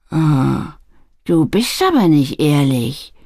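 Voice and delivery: lightly voiced stern woman; gently scolding, with mild gravity; a touch of grave disapproval; stern reproach. A narrator's reading. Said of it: "sagte Mrs. Holland ernst".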